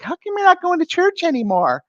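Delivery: very high-pitched nagging mother; mock motherly question in falsetto; imitating mom. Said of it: "my mom's like".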